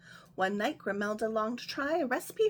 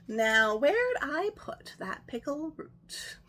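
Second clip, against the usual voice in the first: slow, drawn-out, louder wondering; slow puzzled witch; 4 semitones higher, 5 dB louder, slower than usual. Acted. Witch looking for the root again at the end, slow and puzzled. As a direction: draw it out, puzzling aloud.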